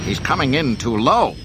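loud, high shout of alarm. A narrator's reading. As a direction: shout a warning in disbelief. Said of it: "the Imperial deck officer shouted".

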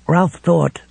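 ordinary delivery

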